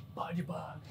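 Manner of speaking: shouting under his breath, barely audible; mock quiet shout. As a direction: yell it but way too soft, mocking. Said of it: "Tapos sumisigaw ka. Pero mahina lang". (pabulong na sigaw, nang-aasar)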